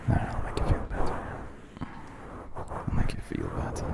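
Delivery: soft unvoiced whisper; ASMR whisper. Shown as a demonstration. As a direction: hush it close to the mic. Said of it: "German sends you tingles".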